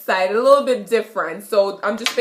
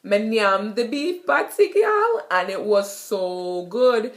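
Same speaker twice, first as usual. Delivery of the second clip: Jamaican patois. Shown as a demonstration.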